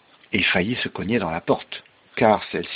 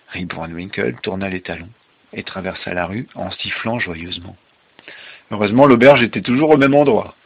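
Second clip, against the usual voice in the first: story narration; 3 semitones higher, 9 dB louder than usual. Acted. Father ending the tale for a child, story narration. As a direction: bring the tale to a big finish.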